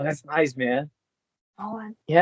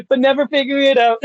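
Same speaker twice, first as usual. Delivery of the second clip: laughing; 12 semitones higher, 6 dB louder, faster than usual. Real, not acted.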